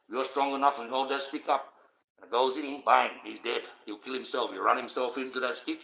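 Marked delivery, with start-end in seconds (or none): none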